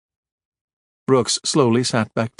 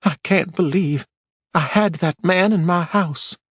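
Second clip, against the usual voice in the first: a touch of a shiver; 8 semitones higher, slower than usual. A narrator's reading. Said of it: "She shivered a bit".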